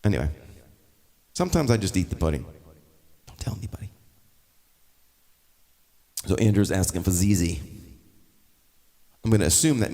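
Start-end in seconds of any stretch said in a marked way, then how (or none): 3.3-3.9 whisper. Pretend. Confiding a secret to viewers.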